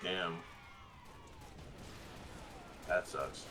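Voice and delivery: low, soft, understated dismay; subdued reaction. Retold or imitated. Recalling a reaction to the Mulan filming news, subdued. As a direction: say it small and low, as if to yourself. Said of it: "I was like".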